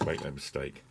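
usual speaking voice

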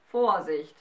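a loud warning. A narrator's reading. The fire giant warns Galatea, loud and stern. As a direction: warn her loudly, once. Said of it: "warnte er wieder".

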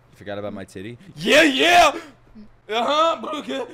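normal talking voice

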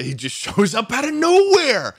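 high, slow, hammed-up amazed voice; mock wonder voice. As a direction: play it like a breathless fan. Joins mocking bit, high voice.

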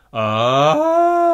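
high head voice scream on ah; head voice fry scream. Shown as a demonstration. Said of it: "your head voice".

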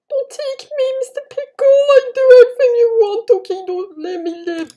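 high, loud frantic begging; panicked pleading. Real, not acted. Player begging Mister Pickle, panicked pleading. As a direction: beg for your life in a squeal.

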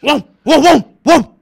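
loud barking woof woof; demo dog barks. Shown as a demonstration. Showing the girl on the phone how a dog barks, loud barks. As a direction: bark like a dog to show how. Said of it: "[name]がお手本見せるから".

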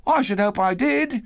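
put-on high-pitched boyish answer; reply as a young lad. A narrator's reading. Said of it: "replied the lad".

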